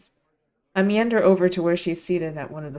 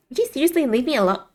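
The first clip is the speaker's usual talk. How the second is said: quick, higher irritated brush-off; annoyed; 7 semitones higher, faster than usual. Acted. Friend shooing away a pestering fake waiter, annoyed. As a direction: shoo off a pest, quickly.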